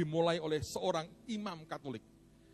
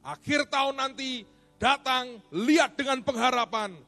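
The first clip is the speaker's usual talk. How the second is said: loud, high, forceful sermon delivery; fiery preaching; 9 semitones higher, 9 dB louder than usual. Acted. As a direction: preach it with full force to the congregation. Preacher at full force opening.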